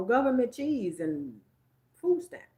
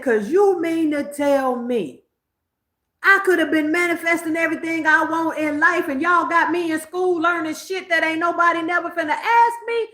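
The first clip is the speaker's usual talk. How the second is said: mock-furious rant at the school system; heated outraged rant; 8 semitones higher, 4 dB louder than usual. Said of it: "I was on ten".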